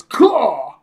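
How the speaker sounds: high, loud crow caw; bird caw. Retold or imitated. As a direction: squawk like the bird. Bird on scientist's head.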